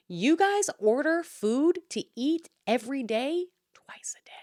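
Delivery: hushed, catty whisper; whispered aside. Real, not acted. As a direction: whisper it like a secret dig. Snide aside about neighbors.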